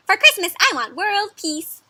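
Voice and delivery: high, slow, loud wish; sing-song childlike request; earnest little mouse. Acted. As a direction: wish it like a tiny critter. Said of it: "says this little mouse".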